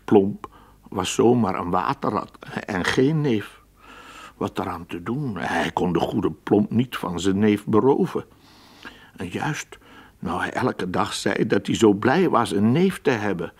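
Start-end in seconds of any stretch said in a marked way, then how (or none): none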